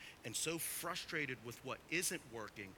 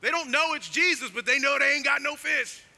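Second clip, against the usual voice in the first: loud, raised pulpit voice; full-force preaching; 10 semitones higher, 15 dB louder than usual. Real, not acted. Preacher urging the congregation to read the disciples' tone, full-force preaching.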